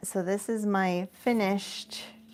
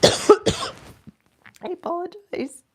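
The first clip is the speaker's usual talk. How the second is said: strained after coughing; 11 semitones higher, 8 dB louder, much slower than usual. Real, not acted. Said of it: "my water went down the wrong way".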